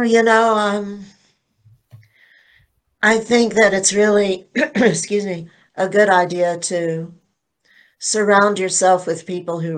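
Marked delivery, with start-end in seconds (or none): none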